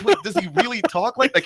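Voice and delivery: high, incredulous question; mock disbelief. Listener baffled by the announcer's odd voice, incredulous.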